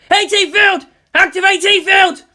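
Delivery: high, loud panicked call; battle shout. Acted. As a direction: yell the warning to the crew.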